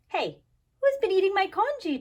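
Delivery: loud, high demanding father; indignant loud demand. A narrator's reading. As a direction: demand to know who ate it. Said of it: "demanded Mister Chan".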